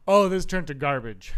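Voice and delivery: slow, loud dawning dismay; loud mock realization. Viewer realizing the film has turned bad, loud dismay. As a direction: announce it slowly and loudly, appalled. Acting viewer's sudden disgust.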